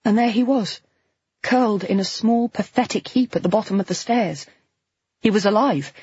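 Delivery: ordinary delivery, nothing marked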